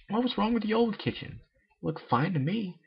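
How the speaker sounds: a sporty pony losing patience, voiced high; high-pitched cartoon pony insisting it won't work; Rainbow Dash voice.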